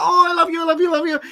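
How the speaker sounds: high, loud adoring gush; gushing fan. Retold or imitated. Fan telling Stephen and Walter she loves them, high gush. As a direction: overflow like a starstruck fan. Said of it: "it was almost embarrassing".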